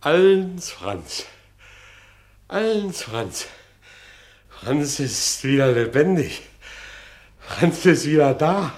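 high, drawn-out exultation; jubilant crowing. Acted. Franz celebrating his return to life, jubilant. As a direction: crow it out, stretching the words. Man crows that he is back.

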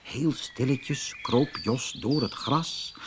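ordinary delivery, nothing marked

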